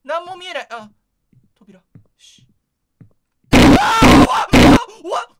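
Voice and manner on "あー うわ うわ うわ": terrified screaming; loud startled shrieks; hit hard crying out. Real, not acted. Jump scare, three screams. (恐怖で絶叫する)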